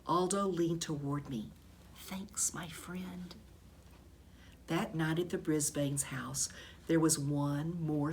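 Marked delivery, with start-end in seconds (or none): 2.0-3.3 whisper. A narrator's reading. Aldo leans close to hamster.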